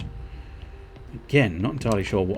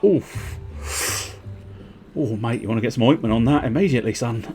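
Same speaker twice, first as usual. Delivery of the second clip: quick, higher-pitched mock-concerned mate; mock matey warning; 5 semitones higher than usual. Mate advising a card player to see a doctor, mock-urgent warning. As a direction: urge him urgently, in jest.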